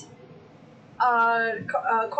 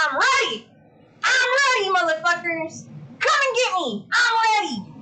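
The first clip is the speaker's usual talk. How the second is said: high, loud shouted challenge; mock battle cry; 7 semitones higher, 3 dB louder than usual. Real, not acted. Streamer challenging a fly to fight, mock shouting. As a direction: hype yourself up to fight a fly.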